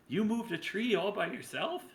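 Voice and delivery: high tiny pixie voice; a tiny voice, pitched high. Acted. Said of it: "a tiny little voice".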